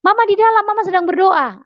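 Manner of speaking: high-pitched imitation of a child answering; child's voice. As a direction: answer Dad like a little kid. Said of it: "Anak saya bilang gitu".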